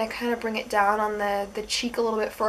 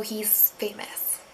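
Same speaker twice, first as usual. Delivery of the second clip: whisper; 11 dB quieter, slower than usual. Real, not acted. Whispered joke aside.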